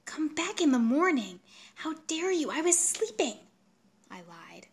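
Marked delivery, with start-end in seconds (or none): 0.1-3.4 indignant refusal. A narrator's reading. Angry refusal at night door.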